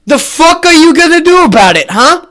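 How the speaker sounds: loud, high, swaggering mock Biden; cocky caricature of the president taunting critics. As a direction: bark it like a bully daring them. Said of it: "Joe Biden goes".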